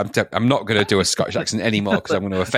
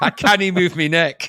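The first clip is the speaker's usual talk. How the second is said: higher mock Scottish voice; Scottish accent; 6 semitones higher than usual. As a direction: complain in a put-on Scottish accent. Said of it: "he's Scottish, so he's not gonna speak like that".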